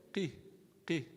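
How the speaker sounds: "Ki" said with lips rounded as for a dhamma, the error; ki with rounded mouth. Shown as a demonstration. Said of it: "pronouncing a ki, but your mouth is in a position of a dhamma would be incorrect".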